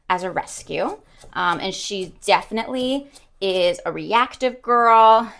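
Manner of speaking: ordinary delivery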